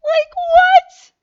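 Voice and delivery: very high, loud incredulous squeal; hammed-up shocked outburst; flabbergasted. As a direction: squeal it in comic disbelief. Still acting shock at family knowing.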